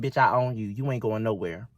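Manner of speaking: low, cold slave master threat; menacing master. Retold or imitated.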